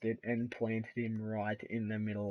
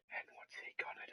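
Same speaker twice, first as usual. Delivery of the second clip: whisper; 15 dB quieter, much faster than usual. Acted. Suspense before top rope move.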